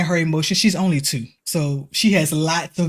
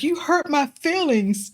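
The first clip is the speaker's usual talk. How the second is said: slow, higher, lightly voiced hurt child; a hint of a two-year-old's wounded complaint; imitating hurt toddler; 10 semitones higher, slower than usual. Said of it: "And she's like".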